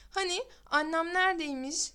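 usual speaking voice

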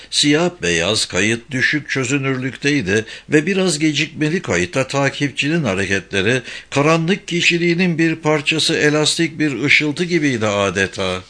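plain reading voice

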